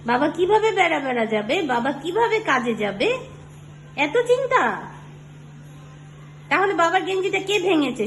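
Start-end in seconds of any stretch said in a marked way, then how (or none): 4.0-4.6 mock surprise. Real, not acted. Mother teasing toddler's worry.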